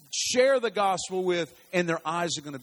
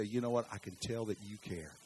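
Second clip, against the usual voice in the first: soft retold words; 7 semitones lower, 8 dB quieter than usual. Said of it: "come up and say".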